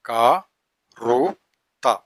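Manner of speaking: ക-റു-ത്ത split into slow beats; syllable by syllable. Shown as a demonstration. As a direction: tap out ക-റു-ത്ത one syllable at a time. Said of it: "syllable-by-syllable demo".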